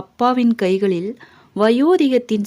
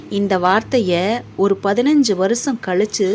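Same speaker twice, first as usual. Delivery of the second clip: usual speaking voice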